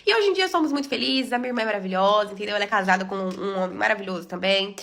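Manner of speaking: ordinary talk, nothing marked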